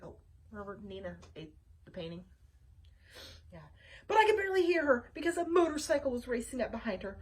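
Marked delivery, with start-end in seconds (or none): none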